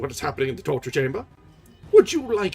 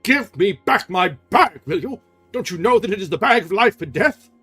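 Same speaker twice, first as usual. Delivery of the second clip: furious demand; 9 semitones higher, 11 dB louder than usual. Acted. Erik demanding his bag back, furious. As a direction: lean hard on every word. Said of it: "the furious voice".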